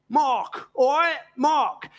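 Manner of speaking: lightly voiced Cockney Mark, all right; a hint of a Cockney East Ender calling Mark; Cockney greeting. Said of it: "in Cockney slang".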